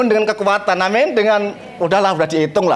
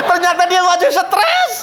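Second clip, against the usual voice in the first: "Ternyata dia masih stres" higher and laughing; laughing repeat; 8 semitones higher than usual. Shown as a demonstration. ("Ternyata dia masih stres" diulang sambil tertawa, nada tinggi)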